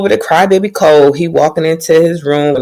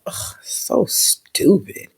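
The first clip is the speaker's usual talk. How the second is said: slow soft breathy aside; whispered aside; 3 semitones higher, 10 dB quieter, much slower than usual. Real, not acted. Vlogger judging the knight's blunder, slow whispered scorn. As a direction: mutter it under your breath, disgusted.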